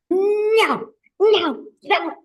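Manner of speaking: put-on high, loud little-kid refusals; toddler's no. Teacher imitating a toddler refusing, high and loud. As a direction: chant no like a stubborn two-year-old. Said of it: "what their favorite word is".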